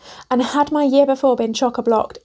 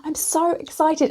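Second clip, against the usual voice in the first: overacted excitement; 6 semitones higher than usual. Shown as a demonstration. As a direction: gush like you just landed a client. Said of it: "we are acting as if that's happened".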